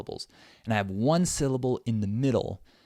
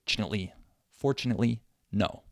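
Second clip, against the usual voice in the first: CHINATELY rattled off quickly, then FORTUNATELY compressed the same way; rapid reduced syllables; much faster than usual. Shown as a demonstration. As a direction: rush CHINATELY as one quick run, stress FOR and NO. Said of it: "say them really fast".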